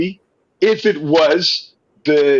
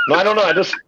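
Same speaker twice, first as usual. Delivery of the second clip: ordinary talk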